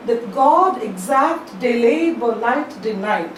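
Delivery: "That God exact delay light denied" read slowly, every second syllable stressed; iambic stress demo. Shown as a demonstration. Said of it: "let me read a sample of how it has to be read".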